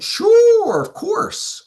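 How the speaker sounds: high, drawn-out imitated "sure", tailing off airy; mock eager agreement. Client agreeing to introduce his brother-in-law, imitated as eager.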